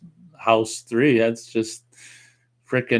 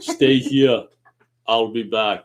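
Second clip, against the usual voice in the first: deadpan Austrian action-hero impersonation; thick Austrian-accented action star; Arnold Schwarzenegger; slower than usual. Acted. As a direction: do a famous movie-cyborg catchphrase. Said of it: "Give us an Arnold impersonation".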